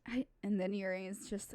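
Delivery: ordinary delivery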